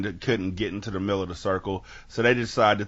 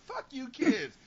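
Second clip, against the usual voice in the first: mock exasperation; 4 dB quieter, slower than usual. Retort to the whispering kids.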